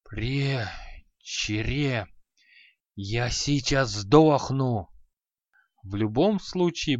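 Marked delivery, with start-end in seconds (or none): 0.0-4.9 exhausted, breathless. Acted. Exhausted mage, staggering.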